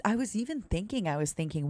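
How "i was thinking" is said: ordinary talk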